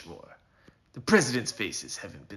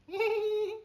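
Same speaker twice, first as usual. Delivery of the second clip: high squeaky snicker; a quick gleeful little snigger; cowboy snickers at his coming revenge. Acted. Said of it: "I snickered internally".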